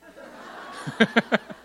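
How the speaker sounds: high burst of laughter; amused laughing outburst. Real, not acted. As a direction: break into a real laugh. Laughs before giving the answer.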